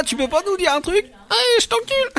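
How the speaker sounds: tiny squeaky voice; little high voice. Pretend. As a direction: pitch it way up, squeaky. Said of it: "La petite voix". (petite voix aiguë)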